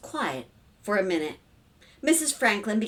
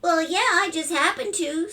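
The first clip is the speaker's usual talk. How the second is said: put-on little boy voice; 9 semitones higher, 3 dB louder than usual. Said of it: "Stevie said".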